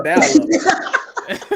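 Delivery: high drawn-out teasing; mock caption. Host teasing a guest whose mic lags, slow high jeer. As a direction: stretch it slowly up high. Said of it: "It should say".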